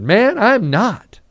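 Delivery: slow, high, loud refusal; defiant denial. Acted. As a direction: deny it defiantly, drawing it out. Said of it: "Very defiantly, very profoundly saying".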